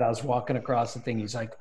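normal talking voice

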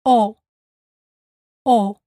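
O said as a short pure Spanish vowel, no glide. Shown as a demonstration. Teacher modelling the Spanish O, short and pure. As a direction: clip the O clean with no U after it. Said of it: "make sure the final O sounds like this".

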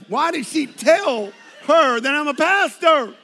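hammed-up outrage at his wife; exasperated. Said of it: "I'm like".

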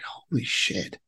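a whispered holy shit; awed whisper. Retold or imitated. Gazing over the city at night, a hushed awed curse. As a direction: breathe it out in hushed awe.

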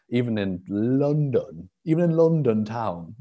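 mock Cockney London accent, lightly put on. Coach joking about snow, in a mock London accent. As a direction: stretch it out like a Londoner. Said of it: "that was not a London accent".

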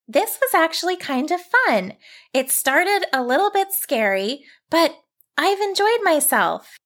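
put-on high cheerful girl; girl relieved and happy; young girl voice.

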